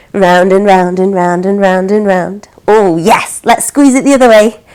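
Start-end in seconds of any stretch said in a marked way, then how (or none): 0.1-2.5 low, even, continuous circling chant; low-pitched rhythmic stirring patter; sing-song stirring. Pretend. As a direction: keep it rolling round like a stirring spoon. Acting out stirring the porridge.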